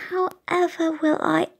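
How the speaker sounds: Tilly fretting about rules, lightly voiced; child wondering. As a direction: puzzle it out as a small girl. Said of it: "she wonders".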